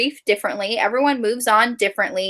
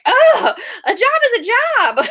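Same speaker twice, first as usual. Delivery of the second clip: much higher, laughing, breezy comeback; laughing mock reply; 14 semitones higher, 4 dB louder than usual. Answering the snide book club women, laughing. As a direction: toss it off through a laugh. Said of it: "I'm just like".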